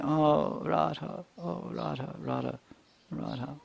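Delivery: Krishna softly weeping for Radha; crying softly. Acted. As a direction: weep her name under your breath.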